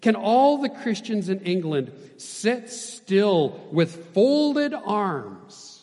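loud, high challenge, last words drawn out; scolding. A narrator's reading. Hudson Taylor to Christians in England, scolding. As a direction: scold them, stretching the final words. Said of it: "he scolded".

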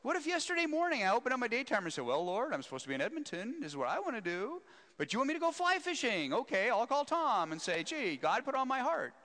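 hammed-up sarcastic prayer; joking mock prayer. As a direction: tease a friend by hamming up the prayer. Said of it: "I was joking".

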